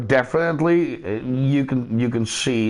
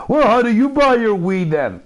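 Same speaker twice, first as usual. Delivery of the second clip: imitated American friend; 6 semitones higher, 4 dB louder than usual.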